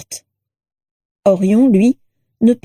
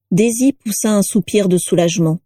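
ordinary delivery, nothing marked

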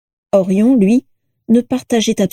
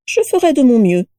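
playful lilt, starting high; mischievous; 4 semitones higher than usual. A narrator's reading. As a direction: promise it with a sly smile. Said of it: "avec un sourire malicieux".

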